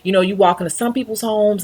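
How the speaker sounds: ordinary talk, nothing marked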